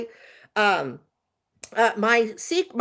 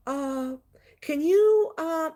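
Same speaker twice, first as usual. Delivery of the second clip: higher, halting imitation of a passenger; hesitant passenger; 7 semitones higher, much slower than usual. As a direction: imitate someone hemming before a favor. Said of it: "He only talked like this".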